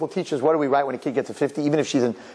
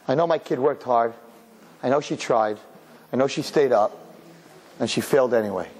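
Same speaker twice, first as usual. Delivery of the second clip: lower-pitched, gentle reassurance; calm supportive parent; 4 semitones lower than usual. Acted. Parent calmly reviewing the failed test with child, supportive. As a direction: take it easy, encouraging the child.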